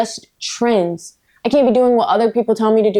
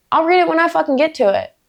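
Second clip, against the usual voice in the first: defiant; 7 semitones higher than usual. Real, not acted. Defiant rant against BookTok pressure.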